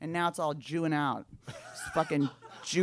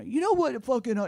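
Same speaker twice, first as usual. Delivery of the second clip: put-on drunken confrontation; 9 semitones higher, 3 dB louder than usual. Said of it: "you leave a party before".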